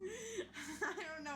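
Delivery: slow words through real laughter; can't stop laughing; laughing helplessly. Real, not acted. Player cracking up at friend's face. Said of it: "laughing at Chad face".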